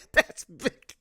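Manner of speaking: laughing. Real, not acted. Laughing at the royals' land over viewers. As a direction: burst out laughing on it.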